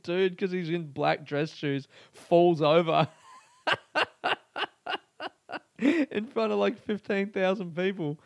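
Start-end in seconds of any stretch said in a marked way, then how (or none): 3.7-5.0 squealing laugh, way up high; delighted high-pitched laugh; high burst of laughter. Real, not acted. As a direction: crack up at the fall. Laughing at guard falling.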